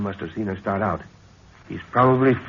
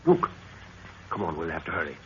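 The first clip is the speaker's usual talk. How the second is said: normal talking voice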